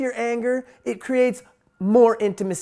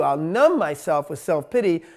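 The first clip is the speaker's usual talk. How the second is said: normal talking voice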